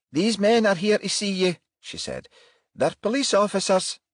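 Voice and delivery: put-on high, anxious older woman; worried, faltering woman; nervous mother. A narrator's reading. Mother speaking.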